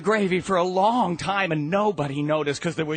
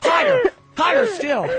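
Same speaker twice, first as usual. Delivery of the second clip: much higher, louder; building higher and louder; higher each time; 6 dB louder than usual. Shown as a demonstration. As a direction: climb up high on each word. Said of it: "a much higher cough".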